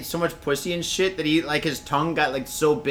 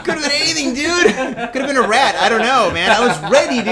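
excited; 8 semitones higher, 7 dB louder than usual. Real, not acted. Excited retelling of scare.

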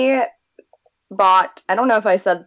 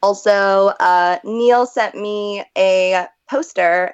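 ordinary talk

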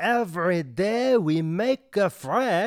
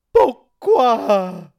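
mock offended gasp; 4 semitones higher, 10 dB louder than usual. Waiter feigns hurt outrage.

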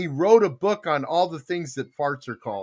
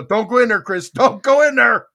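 loud, high mock warning with a laugh; urgent warning; 5 semitones higher, 8 dB louder than usual. Boss warning assistant outside his foul office, urgent. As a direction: warn them off loudly, half laughing.

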